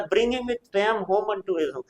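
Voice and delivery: slow, deliberate recital; slow recitation. Shown as a demonstration. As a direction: read the Shakespeare line very slowly. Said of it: "first citizen बोलता है".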